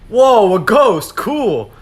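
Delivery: slow, drawn-out, loud mock awe; unimpressed kid. Kid meeting a ghost, slow and loud mock awe. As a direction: stretch it out like you're barely impressed.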